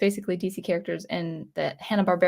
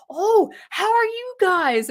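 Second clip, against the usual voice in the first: gushing greeting; 12 semitones higher, 4 dB louder, slower than usual. Retold or imitated. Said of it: "they just like".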